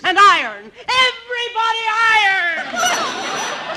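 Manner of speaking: loud, slow barked command, pitched high; bossy shout. Acted. Wife orders husband to iron, with a loud bossy shout. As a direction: order everyone to work at full volume.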